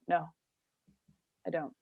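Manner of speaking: curt, flat refusal with a long pause; blunt flat no. Retold or imitated. She refuses the friends with a blunt flat no. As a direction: shut it down, no explanation. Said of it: "just say it like that".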